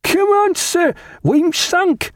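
high-pitched rousing cry, drawn out; steward's cry. A narrator's reading. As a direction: call out urgently to wake a sleeper. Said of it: "the steward's cry".

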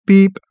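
high, loud imitated beep; phone beep. A narrator's reading. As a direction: mimic an answering machine's high beep. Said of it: "said the phone".

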